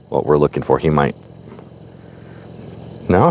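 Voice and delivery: normal talking voice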